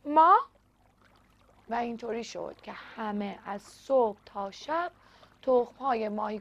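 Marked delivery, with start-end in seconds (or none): 0.1-0.4 loud startled question; surprised. A narrator's reading. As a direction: blurt it out in disbelief. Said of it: "اونا با تعجب پرسیدن".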